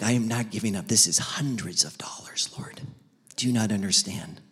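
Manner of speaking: clinging to his CDs, hushed; pleading whisper. Retold or imitated. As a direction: whisper your objection to God. Arguing with God over CDs.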